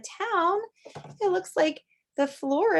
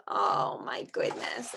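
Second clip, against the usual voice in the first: breathy, slow, awed whisper; delighted whisper; 4 dB quieter, slower than usual. Real, not acted. Teacher awed by a page for the class, whispered. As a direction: whisper it in wonder.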